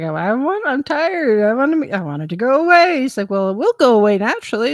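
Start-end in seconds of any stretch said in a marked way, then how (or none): 0.0-3.0 loud, high-swooping whine; exasperated retold whining; whiny complaint. Retells whining to doctor. 3.3-4.7 lightly voiced brisk doctor; reply briskly, as if it's obvious; mildly smug quick answer; matter-of-fact doctor. Doctor's answer in retold exchange.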